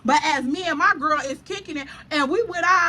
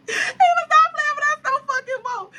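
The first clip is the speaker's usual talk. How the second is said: laughing; 13 semitones higher than usual. Real, not acted. Laughing at her own story.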